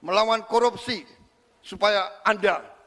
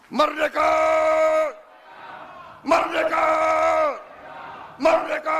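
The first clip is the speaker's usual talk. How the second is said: battle cry; 9 semitones higher than usual. Real, not acted. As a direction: roar it to rouse the crowd. Leader ends speech with rally cry.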